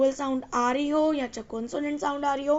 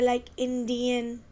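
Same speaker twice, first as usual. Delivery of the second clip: slow demo word; 4 dB quieter, much slower than usual. Shown as a demonstration. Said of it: "ई sound आ रही है यहाँ".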